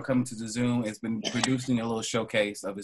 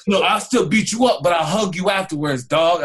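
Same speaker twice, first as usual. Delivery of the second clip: louder, mock-tough threat; playful shout; 6 semitones higher, 6 dB louder than usual. Real, not acted.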